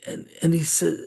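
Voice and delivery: ordinary delivery, nothing marked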